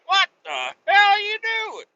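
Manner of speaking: high shrill mom shriek; mother catching her kid mid-mischief, high yell. Retold or imitated. Mom catching him cutting shirt.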